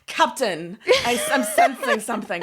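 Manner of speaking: a half-laughing, hammed-up Deanna Troi sensing something; badly faked empath counselor, half giggling; Deanna Troi impression. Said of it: "that is a terrible accent in every fucking way".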